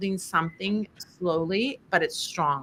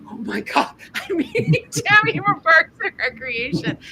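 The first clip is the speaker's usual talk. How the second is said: squealing high and loud with a laugh; fangirling; 12 semitones higher, 5 dB louder than usual. Retold or imitated. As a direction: gush like a starstruck fan, much higher and louder. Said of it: "I fangirled hard".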